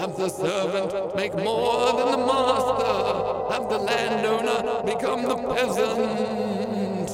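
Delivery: slow spooky ghost voice; drawn-out ghostly moan; ghostly Lenin. Acted. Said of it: "this is ghostly Lenin".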